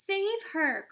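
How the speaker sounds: high, loud desperate cry; cried out. A narrator's reading. Mother crying out to save her daughter. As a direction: beg at the top of her voice. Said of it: "cried Missus Beauregard".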